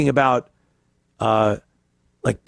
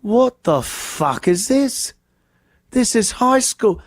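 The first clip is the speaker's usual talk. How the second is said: higher, slow disbelief; amazed visitor; 6 semitones higher than usual. Retold or imitated. UK visitor on a football field, mock amazed. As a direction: gawk at the size of it. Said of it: "And he's like".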